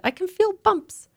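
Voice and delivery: put-on high, fretful child's voice; a small girl sure she's itching; whining little girl. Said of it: "I said".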